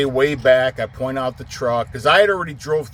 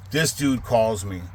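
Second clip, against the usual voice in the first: ordinary talk, nothing marked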